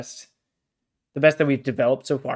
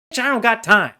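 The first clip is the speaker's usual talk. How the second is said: higher, louder mock outburst; exasperated retort; 8 semitones higher, 5 dB louder than usual. Fed-up reader talking back to the author, exasperated. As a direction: fire back in comic exasperation.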